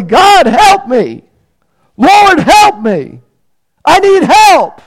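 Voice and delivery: high, loud, slow pleading cry for help; desperate cry. Acted. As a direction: cry out to God in anguish. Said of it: "He cried out audibly".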